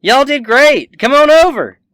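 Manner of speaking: high, full-volume holler from a distance; high hollering call; yell. Acted. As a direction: yell it out loud to people far off. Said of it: "you hear a Dapper Gwen yell".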